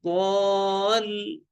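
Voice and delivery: "ضال" stretched, closing on firm l; very slow sustained "ضال"; long madd, shadda. Shown as a demonstration. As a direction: hold aa, then press the l of "ضال". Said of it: "تجديد".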